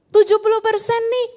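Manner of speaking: high, loud imitation of someone touting a statistic; mock alarmist claim. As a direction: throw the figure out like shocking news. Voicing an alarmist statistic being rebutted.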